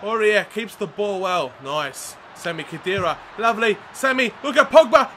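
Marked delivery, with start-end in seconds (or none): none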